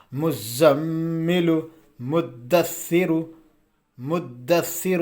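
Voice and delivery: مزمل and مدثر recited slowly, doubled consonants pressed and held; firm doubled-letter reading. Shown as a demonstration. Teacher modelling tashdeed for students, firm slow مزمل and مدثر. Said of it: "سختی سے".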